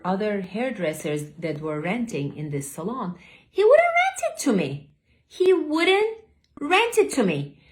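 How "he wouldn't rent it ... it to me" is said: high, louder, outraged; indignant. Retold or imitated. Telling viewers about the owner who refused her, indignant. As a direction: let the injustice ring out.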